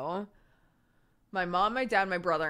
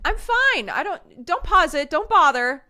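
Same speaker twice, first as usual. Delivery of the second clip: much higher, louder flustered mom reassurance; mom's high fussing voice; 12 semitones higher, 7 dB louder than usual. Retold or imitated. Mother calling out not to pause the TV, much higher and louder. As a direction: mom insisting she's fine while hopping up. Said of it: "be like".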